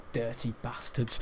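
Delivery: low, very soft mutter; hushed swearing under the breath, re-enacted; muttered aside. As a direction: mutter it furtively to a classmate. Retold aside to classmate.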